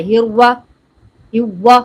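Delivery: "ہوا" loud, with w in place of heavy r; wrong waw sound. Shown as a demonstration. Teacher mimics how people misread, loud w-sound "ہوا". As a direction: round the r away into "ہوا". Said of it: "ایسے پڑھتے ہیں نا؟".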